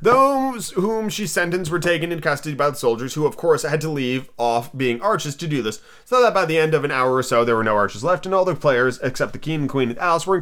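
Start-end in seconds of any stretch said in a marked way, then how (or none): none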